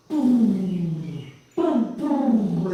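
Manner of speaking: imitated jake brakes.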